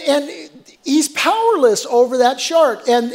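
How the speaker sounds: usual speaking voice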